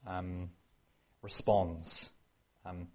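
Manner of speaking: normal talking voice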